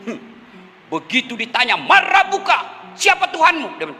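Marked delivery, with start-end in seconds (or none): 1.7-3.8 angel's questioning, pitched high; malaikat bertanya. Acted. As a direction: interrogate the dead man on a raised pitch. Malaikat menanyai pendosa. (malaikat menanyai dengan nada tinggi)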